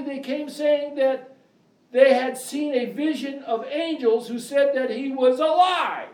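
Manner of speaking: high, ringing pulpit reading; preaching scripture. Acted. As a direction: proclaim the verse to the congregation. Scripture read at full preaching force.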